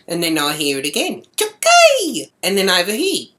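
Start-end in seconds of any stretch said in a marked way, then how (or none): 1.4-2.3 high-pitched loud heckle; imitated yell. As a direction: yell it like a taunting boy calling out. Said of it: "three voices yelling out".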